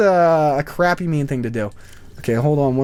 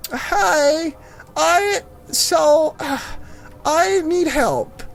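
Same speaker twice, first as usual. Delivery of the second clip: high, drawn-out old lady impression; imitated old lady asking for help; elderly woman; 9 semitones higher, much slower than usual. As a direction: mimic a helpless old woman, pitched way up. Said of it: "it's this old elderly woman".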